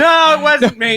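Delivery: flustered denial. Retold or imitated. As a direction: insist it, defeated and flustered. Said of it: "very flustered, just defeated".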